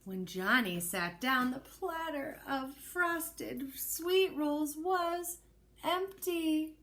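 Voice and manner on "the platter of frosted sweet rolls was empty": usual speaking voice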